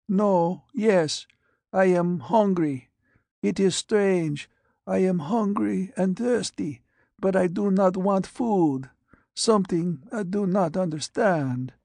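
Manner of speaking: put-on high, frail old man's voice; withered dying patient. Blind radiation patient answers the doctor, high and frail. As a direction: rasp it out like a man aged beyond his years.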